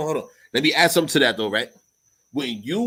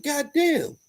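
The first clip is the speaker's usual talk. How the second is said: amazed; 13 semitones higher than usual. Retold or imitated. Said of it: "I'm like".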